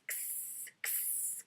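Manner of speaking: soft unvoiced ks clicks; camera x. Shown as a demonstration. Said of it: "it sounds like x".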